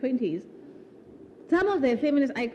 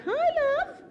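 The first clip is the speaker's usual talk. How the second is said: sweet hello. Retold or imitated. Answering friend's call sweetly.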